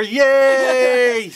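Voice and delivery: high, loud drawn-out yay; cheering. Pretend. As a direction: stretch out a celebratory cheer. Cheering at the sign-off.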